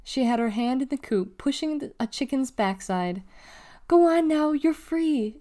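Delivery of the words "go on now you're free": put-on high, raised child's urging with slight rasp. A narrator's reading. Delly freeing a chicken, rasped. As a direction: urge it on, a touch raspy. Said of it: "she rasped".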